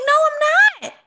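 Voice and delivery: high, loud insistent no; emphatic denial. Retold or imitated. Cohost answering herself in a high, loud denial. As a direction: shout down your own worry. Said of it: "And I'm like".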